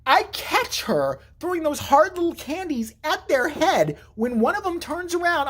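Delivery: usual speaking voice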